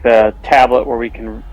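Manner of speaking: normal talking voice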